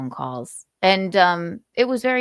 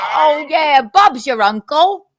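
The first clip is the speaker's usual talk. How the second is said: mock British accent; 3 semitones higher, 5 dB louder, slower than usual. Host answers the caller in mock British accent. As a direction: talk like a chummy London bloke. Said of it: "I can't say whippet in a British accent".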